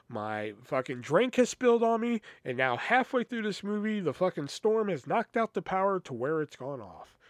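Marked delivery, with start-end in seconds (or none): none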